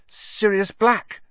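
slow, high, stunned utterance; faint. A narrator's reading. Ron tells Percy who was in the dormitory, faintly. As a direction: say the name slowly, faint with shock. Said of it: "said Ron faintly".

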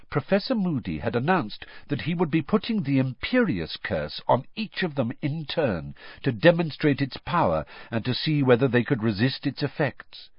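ordinary delivery

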